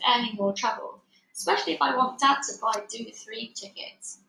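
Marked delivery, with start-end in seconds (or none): none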